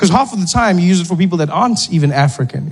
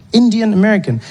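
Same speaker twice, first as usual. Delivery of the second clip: Indian American said higher, with a falling end; repeated in surprise; 7 semitones higher than usual. Shown as a demonstration. Comedian repeating a term he learned at Thanksgiving, higher and wondering. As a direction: echo the phrase in wonder. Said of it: "was Indian American".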